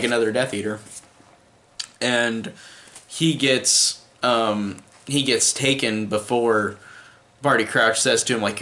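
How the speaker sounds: usual speaking voice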